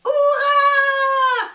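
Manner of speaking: cheering. A narrator's reading. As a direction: cheer like a crowd of mice. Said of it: "s'exclament toutes les souris".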